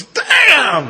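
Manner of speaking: loud, high retold exclamation; stunned outburst. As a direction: shout it like a floored onlooker.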